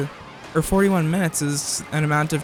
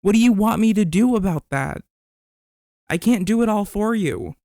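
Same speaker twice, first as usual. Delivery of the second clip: mock anger; 4 semitones higher than usual. Streamer demanding chat answer his question, hammed-up anger. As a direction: ham up losing it at chat. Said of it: "sounding like I'm mad".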